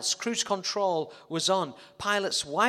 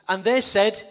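loud cue; 4 semitones higher, 8 dB louder than usual. Acted. Cue to crowd.

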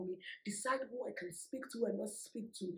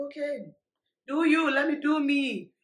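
higher, loud, slow sass; sassy dismissal; 6 semitones higher, 14 dB louder, slower than usual. Retold or imitated. Telling the angry ex to do his thing, sassy. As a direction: brush him off with attitude.

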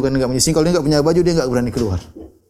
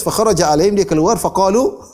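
ordinary delivery

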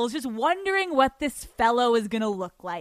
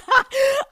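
loud high burst of laughter; high giddy laugh; 10 dB louder than usual. Real, not acted. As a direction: crack up at herself. Giddy at her own story.

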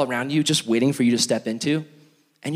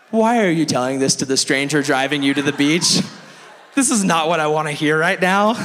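raised, alarmed protest; mock panic; 3 semitones higher, 4 dB louder than usual. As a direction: blurt it out like a panicked driver. Acted inner panic at murderer remark.

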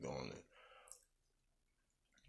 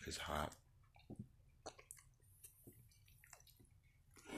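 talking around hot food; words through a hot mouthful; mouth full of hot food; 12 dB quieter, faster than usual. Real, not acted. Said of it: "I just burnt my mouth".

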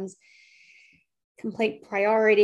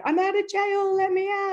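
mock rebel; 11 semitones higher, 4 dB louder than usual. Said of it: "that bit of anarchy".

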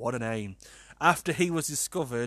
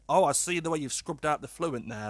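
ordinary talk, nothing marked

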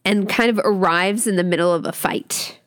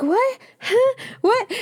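much higher, stunned what; shocked; 13 semitones higher, slower than usual. Retold or imitated. Ava reacting to him undressing, shocked high squeaks. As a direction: squeak it out in disbelief. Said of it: "she's like".

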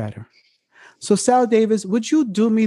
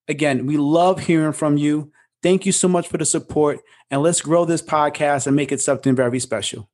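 usual speaking voice

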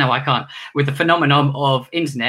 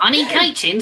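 high puppet squeak; teddy pleading for coaching; 13 semitones higher, 3 dB louder than usual. Acted. Puppet voice replies.

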